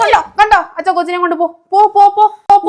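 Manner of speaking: loud, high shouting; heated shout. Acted. Shouting at father and husband mid-fight, loud and high. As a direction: drive them off at full voice.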